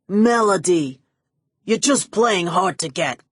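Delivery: loud, wheedling creep voice; lovesick pleading. Acted. Max coaxing Melody, loud wheedling lovesick voice. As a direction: coax her like a besotted fool.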